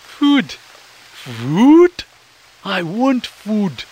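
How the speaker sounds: hungry little critter who can taste it already; drooling for food. Acted. Ian to Mac, drooling over the promise of food. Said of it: "Ian said, drooling".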